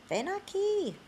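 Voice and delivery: put-on high sing-song call to a child; parent calling child. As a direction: call your kid over in the store. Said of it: "a Spanish-speaking parent say to their child".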